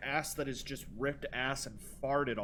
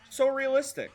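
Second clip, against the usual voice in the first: mock awe; 10 semitones higher, 6 dB louder than usual. Sarcastic praise of game idea.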